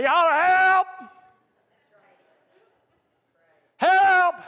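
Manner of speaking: high, loud cry of "help"; desperate yell for help. Retold or imitated. Preacher acting out a man screaming for help while falling. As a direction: shout it like you're falling.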